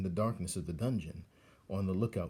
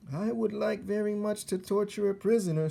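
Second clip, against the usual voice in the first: put-on much higher, eager young rat; Roscuro the rat; 12 semitones higher, 4 dB louder than usual. Young rat tells mentor he wants to torture, eager. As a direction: wish for it hungrily.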